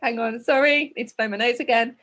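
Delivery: ordinary delivery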